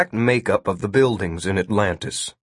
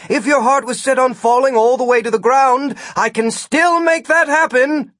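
high, exasperated shouting; heated raised-pitch retort; shouted threat; 14 semitones higher, 4 dB louder than usual. A narrator's reading. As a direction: threaten at the top of your patience. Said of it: "Gwen shouted".

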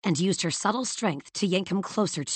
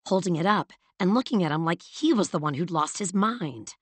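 usual speaking voice

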